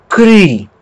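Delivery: Cri with the vowel like French u; Cri held long, French-style u; French U sound. Shown as a demonstration. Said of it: "de U do francês".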